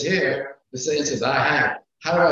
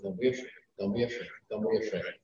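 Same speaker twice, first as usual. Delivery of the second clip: soft repetition; 5 semitones lower, 9 dB quieter, faster than usual. Acted. Repeating God's comfort softly.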